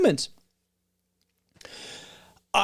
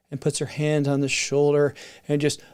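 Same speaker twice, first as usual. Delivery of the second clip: normal talking voice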